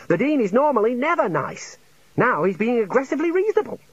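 very high-pitched indignant outburst; frantic yelling. A narrator's reading. Junior wizard denouncing the Dean to colleagues, frantically high. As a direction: pitch it up near hysteria. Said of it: "Ponder yelled, pointing a shaking finger".

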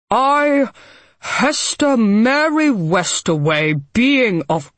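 slow, loud formal will reading; reading aloud. A narrator's reading. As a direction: read the will out slowly. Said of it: "began to read aloud".

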